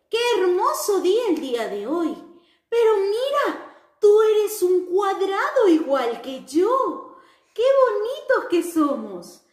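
put-on sing-song greeting for children; cheerful square character. Square greets alarm clock.

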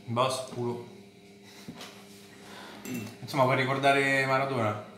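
words slightly muffled by food; talking while chewing. Real, not acted. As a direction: mouth partly full of pasta. Seen 0: food held at mouth, bitten at 1.7, then chews while talking.

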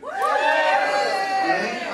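usual speaking voice

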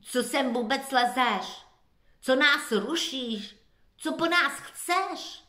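sharp, rising scold. A narrator's reading. Animal scolds Benny the lost dog, rising. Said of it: "začala Bennymu nadávat".

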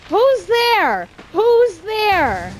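loud, high challenge into the dark; high, loud "who goes there" shout; calling out. Real, not acted. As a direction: call out sharply to whoever is there. Calling out to unseen enemy.

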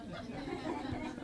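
quiet unvoiced chuckle; soft breathy laugh. Real, not acted. Laugh after punchline.

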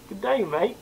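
a light, hammed-up Australian accent; tossing off a mock Australian "Good day, mate"; bad Australian accent. Friend asks for an Australian accent, a lightly put-on Australian twang. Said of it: "Let's hear your Australian accent".

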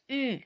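"Ü" as ee said through lips rounded for oo; German ü sound. Shown as a demonstration. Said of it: "Затримуючи губи у тому ж положенні".

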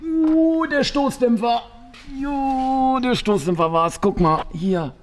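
loud, high dismayed cry; blurting out the bad news; shocked outburst. Real, not acted. Discovering the broken shock absorber.

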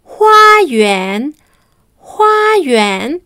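"Huayuan" said carefully, each syllable clear, rising at the end; Mandarin word demo. Shown as a demonstration. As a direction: model "Huayuan" slowly for learners. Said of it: "Vamos a ver las palabras nuevas".

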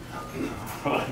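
breathy little madman's laugh; quiet sly laugh; soft unvoiced chuckle. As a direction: snicker at the listener's doubt. Laughs at the reader.

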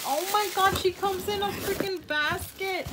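high, loud delighted gush; thrilled, loud and high; excited squeal. Real, not acted. Delighted discovery of basket.

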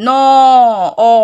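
"ন" held long, trailing into "অ"; inherent vowel demonstration. Shown as a demonstration. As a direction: stretch "ন" to expose its "অ" sound. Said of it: "এরকম, সেই জন্য কিন্তু অযোগ হয়".

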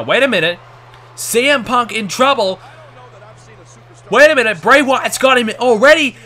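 excited, loud play-by-play shouting; wrestling commentator. Acted. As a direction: call the finish like a ringside announcer. Live match play-by-play.

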